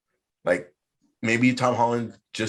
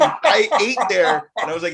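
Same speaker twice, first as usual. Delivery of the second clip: ordinary delivery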